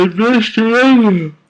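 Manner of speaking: loud, very high put-on voice; hammed-up cry at full tilt; mock-dramatic reading. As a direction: proclaim it absurdly high and loud. Overblown take, then plain redo.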